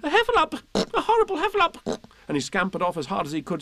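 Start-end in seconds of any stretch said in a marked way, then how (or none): 0.0-1.8 very high, squealing Piglet alarm; panicked cry. A narrator's reading. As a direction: squeal for help in terror. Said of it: "cried Piglet".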